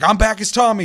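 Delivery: high, loud imitation of a fired-up gamer; pumped up. Revived player tells the narrator he's back, pumped up. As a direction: blurt it like a triumphant comeback. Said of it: "That guy was so fucking pumped".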